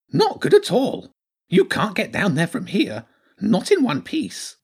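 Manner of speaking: high-pitched, fretful little creature; worried hedgehog character. Acted.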